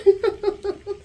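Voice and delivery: high burst of real laughter; laughing out loud at own words. Real, not acted. Vlogger laughs at her own rant, high burst of laughter. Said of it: "I know I'm laughing".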